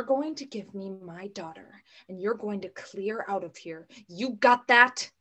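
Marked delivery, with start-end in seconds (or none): 4.1-5.1 slow, loud threat; threatening. Acted. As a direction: spell out the threat. Mother threatens the captor.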